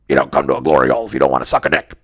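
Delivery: very low, fast, loud rough-guy voice; Mike character voice. Acted. Glory hole voicemail played for Seth's wife, deep fast character voice. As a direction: drop it deep and rattle it off. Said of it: "I do the voice".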